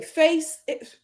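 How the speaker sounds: ordinary talk, nothing marked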